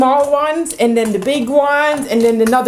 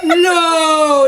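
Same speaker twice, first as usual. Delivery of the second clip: high, drawn-out "no"; loud wail of refusal; indignant protest; 5 semitones higher, 3 dB louder than usual. Real, not acted. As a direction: cry out in objection. Protesting being allowed to win.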